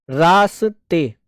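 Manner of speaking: very slow "रास्ते", stretched syllable by syllable; drawn-out sounding out. Shown as a demonstration. Teacher reading back the written word, very slowly. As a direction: sound out "रास्ते" slowly, each part held long.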